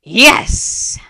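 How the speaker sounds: high, loud, exultant cry; triumphant scream. A narrator's reading. Spirit cheering the broken defenses, triumphant scream. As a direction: scream it in victory. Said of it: "the triumphant scream".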